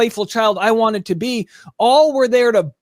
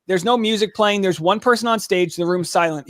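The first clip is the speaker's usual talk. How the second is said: ordinary talk, nothing marked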